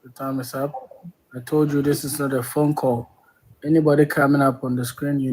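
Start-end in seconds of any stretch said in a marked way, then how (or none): none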